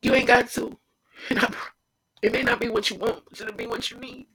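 choking up mid-sentence as tears come; crying. Real, not acted. Urging listeners to trust God, breaking into real tears. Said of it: "you won't understand why I'm crying".

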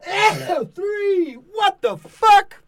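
high pained cries after a sneeze; pained yelp. Real, not acted. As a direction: yelp in real pain. Painful sneeze.

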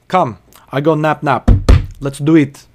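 loud broken English, imitating the customer; a mild caricature of a pushy customer; mock impatient patron. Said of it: "and says".

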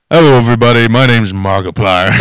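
loud, hyped YouTuber greeting; lightly imitating an energetic YouTuber; Markiplier intro voice. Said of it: "Take off the mask".

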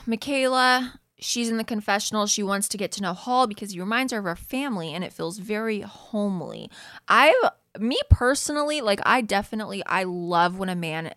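normal talking voice

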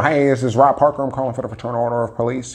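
chipper sales-call voice, fast at the end; telemarketer pitch. Retold or imitated. Doing his old telemarketing call for the police fund, chipper and fast. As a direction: rattle it off like a cold caller.

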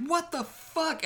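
very high, alarmed what the fuck; startled shriek. Retold or imitated. Sarah reacts to the snake guy, in a very high startled yelp. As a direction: yelp in disbelief. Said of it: "Sarah Bailey's like".

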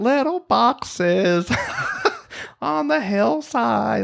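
put-on high, slow creepy old voice; Malvina voice. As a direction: sing it as Malvina, high and drawn out. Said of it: "Do it as Malvina".

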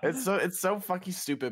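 ordinary talk

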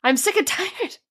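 high-pitched, mock-desperate begging; exasperated plea. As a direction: plead up high, fed up with the story. Acts out exasperated reader's plea.

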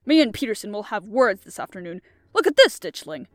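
loud, angry threat; livid rage. A narrator's reading. Crofter complains to Ditchling about Peterson, livid rage. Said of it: "Crofter was livid with rage".

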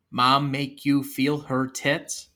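very slow, word-by-word question; deliberate repeated question. Acted. Todd repeats his question, slow and deliberate. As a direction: spell it out slowly for someone who missed it. Acted repeat after not being heard.

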